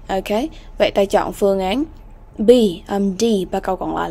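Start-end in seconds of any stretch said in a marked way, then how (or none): none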